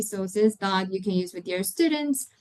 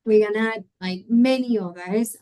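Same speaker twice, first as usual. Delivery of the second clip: ordinary delivery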